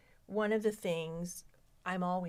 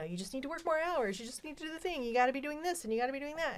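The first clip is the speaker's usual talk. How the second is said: higher mock preachy voice, fast at the end; mock lecturing advice; 7 semitones higher, faster than usual. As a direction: parrot the pushy advice mockingly. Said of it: "how many times have I heard".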